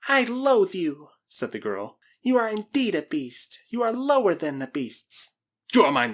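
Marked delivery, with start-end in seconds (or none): 0.1-1.1 high, loud, drawn-out disgust; loathing. Acted. Girl rejects the Spaniard, high slow loathing. 2.2-5.3 high, emphatic contempt; loathing. Acted. Girl condemns the Spaniard, high voice full of loathing. Girl insults her captor. 5.7-6.1 loud, emphatic claim; possessive. Acted. Spaniard claims his captive, loud and possessive.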